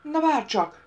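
sudden loud growl. A narrator's reading. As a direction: snap it out as a warning. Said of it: "morrant az egyik ardi".

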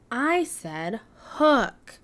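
slow, hook leaned on; slow correction. Acted. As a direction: gently correct the word hook. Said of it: "said Stego".

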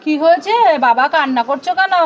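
usual speaking voice

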